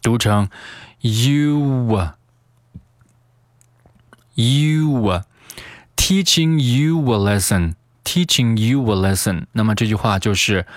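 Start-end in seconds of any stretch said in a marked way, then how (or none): 1.0-9.5 "you a" joined with a w glide, you-wa; "you a lesson" said as you-wuh lesson; added linking sound. Shown as a demonstration. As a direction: slide from "you" into "a" through a w. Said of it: "中间有一个添加连读".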